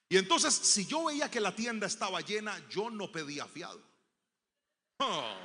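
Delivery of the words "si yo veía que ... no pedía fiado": normal talking voice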